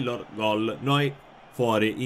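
ordinary talk

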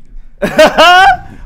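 high shrieking laughter; bursting out laughing; loud laugh. Real, not acted. Joke about Zlatan.

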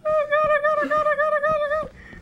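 high panicked chanting; high frantic repeated pleading; panicking gamer. Real, not acted. Chased by monster in game.

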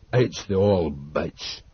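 put-on old Dodd. A narrator's reading. Old Dodd voiced in story.

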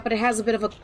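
ordinary talk, nothing marked

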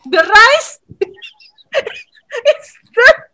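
can't get the words out for laughing; laughing through it. Real, not acted. Teacher laughing at her own clumsy translation, words broken by giggles.

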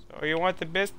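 high, polite put-on voice; eager-to-please neighbour. Neighbour offering the guys a biscuit, high polite voice.

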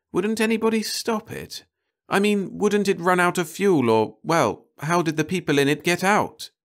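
high, worried woman's voice, pleading questions; a frightened wife pressing her husband for answers; anxious wife. Acted.

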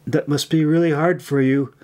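usual speaking voice

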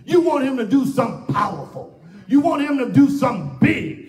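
emphatic pulpit cadence; impassioned preaching. Real, not acted. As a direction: press the congregation hard. Preaches at full force.

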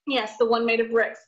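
ordinary delivery, nothing marked

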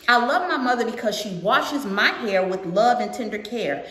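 put-on lilting read-aloud to a young audience; storybook narration with singsong rhythm; picture book read-aloud. A narrator's reading. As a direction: read it out brightly, line by line.

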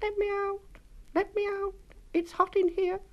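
tiny, very high little voice; very high little gingerbread voice. A narrator's reading. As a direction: squeak it out like a tiny cookie trapped in the oven. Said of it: "a tiny voice saying".

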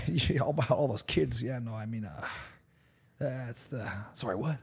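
trailing off, tired and muddled; lightly voiced weary politician losing the thread; Biden impression. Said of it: "Joe Biden just sounds really tired".

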